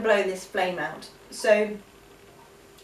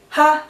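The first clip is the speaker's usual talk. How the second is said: forceful ha; 6 semitones higher, 10 dB louder than usual. Shown as a demonstration. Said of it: "as you're breathing back in".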